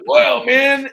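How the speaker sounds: reacting to her wink at the entrance; Macho Man exclaim. Loud high Macho Man exclaim. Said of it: "And I'm like".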